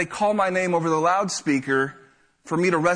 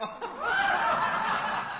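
high-pitched burst of laughter; 7 dB quieter than usual. Real, not acted. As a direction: crack up at the twist. Punchline realization.

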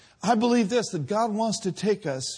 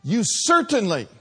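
impassioned pulpit preaching; fiery preaching; 5 semitones higher, 6 dB louder than usual. Acted. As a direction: preach it full force to the congregation. Preaching at full force.

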